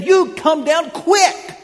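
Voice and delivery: slow, loud and high sneering command; insolent order. Acted. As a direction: demand it with open contempt. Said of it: "He's even more insolent".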